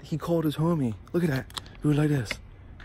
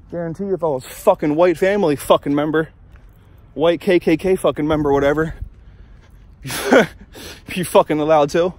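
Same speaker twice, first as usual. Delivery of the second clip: ordinary talk, nothing marked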